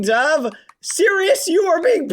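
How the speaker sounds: ordinary talk, nothing marked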